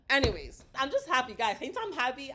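ordinary delivery

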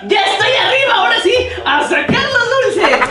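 high excited cheering; excited shout. Acted. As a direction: cheer out with glee. Reached the candy.

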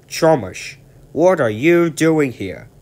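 high, loud demanding question; a boss catching an engine out; stern director. Acted.

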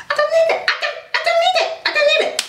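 a light sing-song brush-off; sing-song high refrain. Acted. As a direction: bounce the line up high like a teasing chant. Playful refrain after a plain line.

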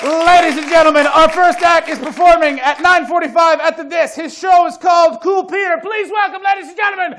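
loud, high boxing-ring introduction; announcer intro. Real, not acted. Host bringing out the first guest, loud, high announcer style. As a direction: build the crowd into the name.

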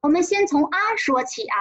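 normal talking voice

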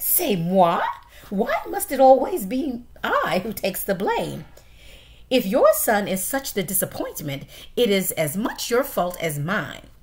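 loud, offended wife with French flair; indignant French mother mouse. Acted. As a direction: throw the blame back at him.

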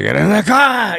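high, very loud mock yell; imitated shouting. Man ordering his girlfriend out, hammed-up shouting. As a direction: holler it as an exploding hothead. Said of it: "they start shouting at each other".

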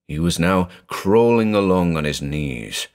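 ordinary delivery, nothing marked

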